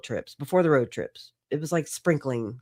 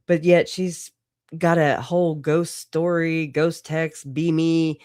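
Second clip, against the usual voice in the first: ordinary delivery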